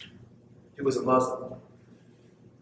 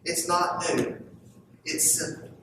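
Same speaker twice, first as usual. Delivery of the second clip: ordinary talk, nothing marked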